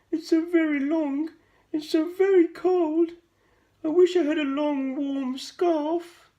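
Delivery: sad giraffe. A narrator's reading. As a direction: whine it like a cold, sad giraffe. Giraffe complains to George.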